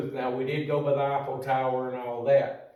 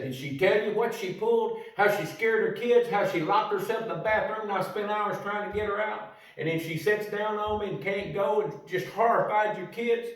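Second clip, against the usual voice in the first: indignant confronting; 6 semitones higher than usual. Retold or imitated. Confronting the boss about wife.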